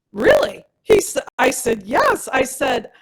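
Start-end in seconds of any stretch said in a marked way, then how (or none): 0.1-0.6 astonished really. Retold or imitated. Doctor astonished at the result. 1.8-2.3 excited yes. Retold or imitated. Excitedly confirming to the doctor.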